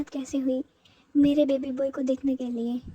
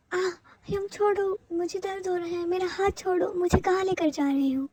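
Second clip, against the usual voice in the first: pained pleading; 4 semitones higher than usual. Acted. Jungkook pleading as his hyung drags him away, in pain. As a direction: beg him to let go of your hand.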